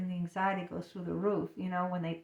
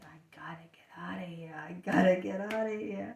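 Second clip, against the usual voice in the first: urgent whispered aside; inner thought. Acted. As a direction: whisper it to yourself while looking pleasant. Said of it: "I'm thinking".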